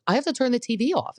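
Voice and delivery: high-pitched rushed distress; fast high-pitched mock panic. Real, not acted. Upset by sad dog ad.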